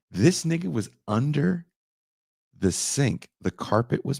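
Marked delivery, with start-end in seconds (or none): none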